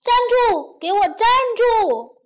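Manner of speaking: high, loud yell; forceful shout. Acted. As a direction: shout hard to halt a runaway. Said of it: "使劲地喊". (使劲地高声喊叫)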